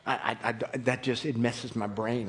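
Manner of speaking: ordinary delivery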